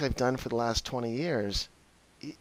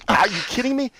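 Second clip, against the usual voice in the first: higher and louder, disbelieving; incredulous outburst; 10 semitones higher, 6 dB louder, faster than usual. Retold or imitated. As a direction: throw it back at her in disbelief. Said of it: "I was like".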